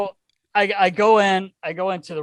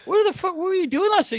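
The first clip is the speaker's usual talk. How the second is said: incredulous; 12 semitones higher than usual. Retold or imitated. Confronting friend next morning.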